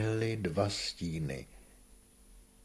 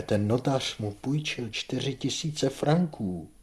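higher-pitched, slow exclamation; dismayed outcry; 6 semitones higher, 4 dB louder than usual. A narrator's reading. Aimé remembers the notary's loan, dismayed outcry. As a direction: cry it out higher, stretching the words. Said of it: "vykřikla Aimé".

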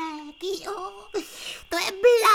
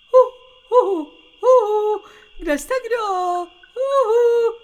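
loud hooting call through the woods; 4 semitones higher, 9 dB louder than usual. Acted. Lost in the forest, calling for friends, loud hooting. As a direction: hoot loudly to find friends.

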